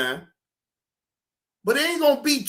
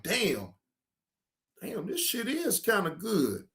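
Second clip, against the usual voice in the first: savoring first taste; 9 dB quieter than usual. Retold or imitated. Stoner to himself, first tasting the strand, soft and impressed. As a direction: murmur it like tasting something good. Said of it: "be like".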